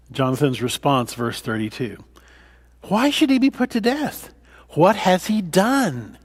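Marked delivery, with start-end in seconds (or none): 2.8-6.2 begging his father to explain; pleading protest. Acted. Jonathan pleading with his father Saul, higher, urgent protest.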